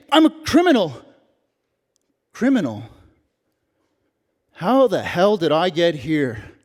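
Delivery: ordinary talk, nothing marked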